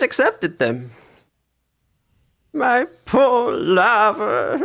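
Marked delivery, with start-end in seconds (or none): 2.5-4.6 sobbing. Acted. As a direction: voice cracking into tears. Grieving mother sobs.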